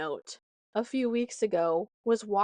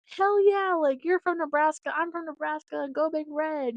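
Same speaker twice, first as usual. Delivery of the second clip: higher, louder hyped retelling; excited cheer; 7 semitones higher, 4 dB louder than usual. Retold or imitated. Stranger cheering back about Nebraska, excited and higher. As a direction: bubble over with hometown pride.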